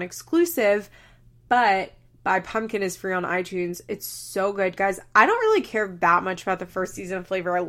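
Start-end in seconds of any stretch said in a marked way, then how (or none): none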